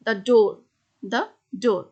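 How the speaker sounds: "the" said "thuh" before "door", slowly. Shown as a demonstration. Teacher showing students "the" as "thuh" before a consonant. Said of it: "তার আগে দা বলবো".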